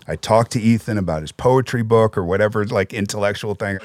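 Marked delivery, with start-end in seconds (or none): none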